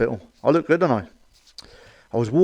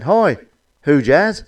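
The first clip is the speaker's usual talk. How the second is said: called greeting; 3 semitones higher, 6 dB louder, much slower than usual. Acted. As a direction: call out "Hi, who Jazz?" brightly. Passers-by greeting the lady.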